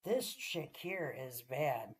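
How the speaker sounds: slow, hushed, drawn-out holy shit; softly stunned disbelief. Retold or imitated. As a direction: awestruck and slow. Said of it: "I'm like".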